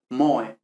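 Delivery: "moe" as MO-e glided in a single beat; one syllable. Shown as a demonstration. Teacher gives intended diphthong, one syllable. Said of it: "en una sola sílaba".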